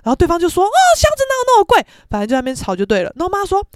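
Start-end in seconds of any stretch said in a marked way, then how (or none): 0.6-1.8 high, loud, rushed objection; mock outraged disbelief; imitated indignant protest. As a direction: object fast, as if being cheated. Other party protesting the price.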